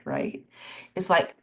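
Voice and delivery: ordinary talk, nothing marked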